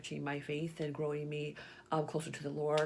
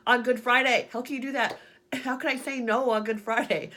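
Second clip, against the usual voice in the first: louder, higher indignant complaint with a laugh; a hammed-up outburst at the Lord; mock angry at God; 7 semitones higher, 9 dB louder than usual. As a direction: protest to God, half laughing. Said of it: "And then I got mad at God".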